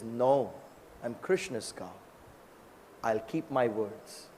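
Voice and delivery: soft, low mild resolve; lightly voiced cow, low and quiet; calm vow. Cow calmly vows to return.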